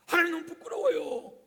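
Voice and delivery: high, loud, ashamed cry to God; bashful wail of shame; ashamed plea. Acted. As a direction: voice the embarrassed sinner pleading. Adam and Eve ashamed before God.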